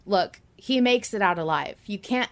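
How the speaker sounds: ordinary talk, nothing marked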